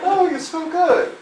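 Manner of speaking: over-the-top delighted swoon; gushing. Retold or imitated. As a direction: gush over the smell.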